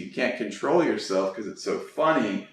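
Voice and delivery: ordinary talk, nothing marked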